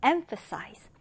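"emphasize" stressed on its first syllable, EM-pha-size, with PH as F. Shown as a demonstration. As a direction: hit the start of "emphasize". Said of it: "첫 음절에 일 강세를 두고요".

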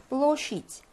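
"blow sheet" slow, stress on "blow", reduced "i" in "sheet"; unstressed vowel reduced. Shown as a demonstration. Teacher shows vowel reduction, "blow sheet" with stressed first syllable. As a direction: stretch "blow", then a lighter "sheet". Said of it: "강세가 없으니까".

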